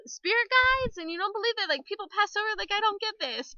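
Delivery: high-pitched incredulous questioning; mock alarm. Woman baffled that others don't share her beliefs, mock alarm. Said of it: "which is always so alarming to me".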